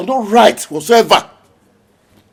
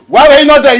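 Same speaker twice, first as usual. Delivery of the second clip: higher, louder accusing yell; angry shout; 7 semitones higher, 9 dB louder, faster than usual. Acted. Accusing Ibe at the wedding.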